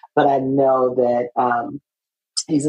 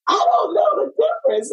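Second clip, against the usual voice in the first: a comic high-pitched cry of confusion; mock panicked squeal. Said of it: "And I was like".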